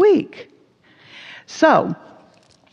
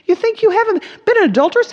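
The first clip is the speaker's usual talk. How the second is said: indignant challenge; 11 semitones higher, 4 dB louder, faster than usual. Acted. Jesus quoted rebuking crowd.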